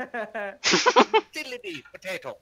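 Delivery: high, drawn-out mock-Irish trill; playful Irish caricature, high and slow. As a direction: sing out a cartoon Irishman's cry. Mock Irishman joke cry.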